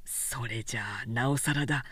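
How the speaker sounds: a tired craftsman surprised she stayed; gruff craftsman. A narrator's reading. Sōshichi scolding Oyuki for staying late, low and stern.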